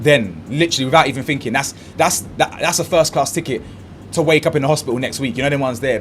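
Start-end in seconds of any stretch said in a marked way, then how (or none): none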